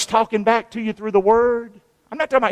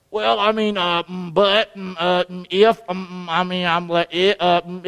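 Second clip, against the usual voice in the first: 1 mock stammering excuse; 2 semitones lower than usual. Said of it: "get to heaven one day and go".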